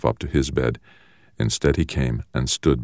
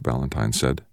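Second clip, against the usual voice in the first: usual speaking voice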